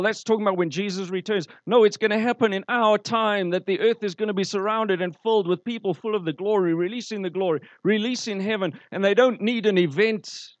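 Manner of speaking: ordinary delivery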